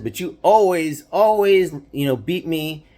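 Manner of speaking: high, aggrieved whine leaning on always; sulky little brother grievance; whiny kid brother. Retold or imitated. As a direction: complain like a sore loser. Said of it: "he would get so mad".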